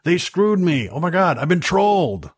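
high-pitched, hammed-up uppity complaint; uppity outrage. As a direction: act the affronted, trolled customer. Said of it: "people just getting all uppity".

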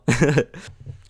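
loud, rising laugh; short laugh. Real, not acted. Laugh after sarcastic hello.

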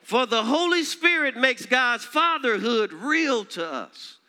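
high, loud, swelling preacher's cry; fiery preacher climax; preaching at full force. Acted. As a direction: lift it up and proclaim it. Preaching surge.

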